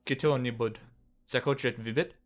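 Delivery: lightly voiced Russian line; a hint of a Russian-speaking servant; Russian-sounding phrase. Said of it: "What is that language?".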